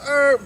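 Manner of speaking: high, loud engine whine; imitated engine revving. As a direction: whine like a car flooring it. Imitating the accelerating car.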